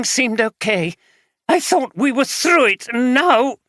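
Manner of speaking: wife recoiling from husband, despairing. Acted. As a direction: push him away in despair.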